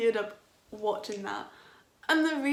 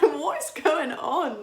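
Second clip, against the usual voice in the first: slow disbelief; 5 semitones higher, 7 dB louder, slower than usual. Retold or imitated. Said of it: "I'm like".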